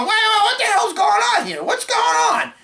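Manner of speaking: high-pitched, alarmed protest; retold outburst. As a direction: squeal it out like you've just been cut in line. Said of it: "And I'm going".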